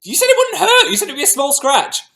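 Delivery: high, loud whiny yelp of pain; mock-hurt childish shriek; big baby wail. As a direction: cry out like a wounded toddler, hammed up. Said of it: "big baby about it".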